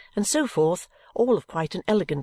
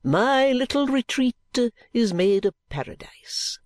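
effusive dandy; 6 semitones higher than usual. A narrator's reading. Dandy flattering his visitors, grandly effusive. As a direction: flatter them with affected grandeur.

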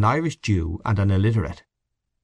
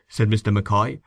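usual speaking voice

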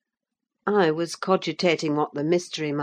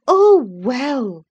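scornful compassion; 7 semitones higher, 9 dB louder than usual. A narrator's reading. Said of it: "with scornful compassion".

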